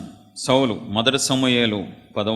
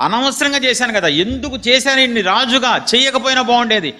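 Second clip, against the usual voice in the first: much higher, loud lament of regret; God regretting; 11 semitones higher, 4 dB louder than usual. Acted. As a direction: voice God sorry he made Saul king. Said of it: "పశ్చాత్తాపడుతున్నారు". (పశ్చాత్తాపంతో దేవుని స్వరం, చాలా ఎత్తుగా)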